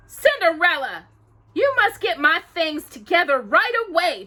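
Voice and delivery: high, loud bossy order; commanding stepsister. Acted. Drusilla ordering Cinderella to fetch her things, commanding. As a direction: bark the order at the maid. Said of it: "Drusilla commanded".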